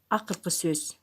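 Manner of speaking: ordinary talk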